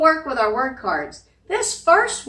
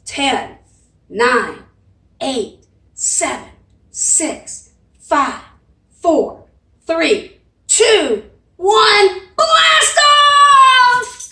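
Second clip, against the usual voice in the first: building louder and higher to a shout; rocket countdown; 7 semitones higher, 5 dB louder, much slower than usual. Shown as a demonstration. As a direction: count down, then launch with a yell. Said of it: "count backwards and blast off".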